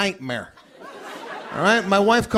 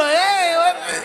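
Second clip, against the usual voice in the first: cook arriving; 9 semitones higher, 4 dB louder, slower than usual. Retold or imitated. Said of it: "he's gonna come out".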